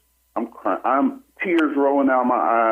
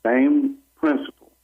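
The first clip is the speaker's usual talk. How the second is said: ordinary talk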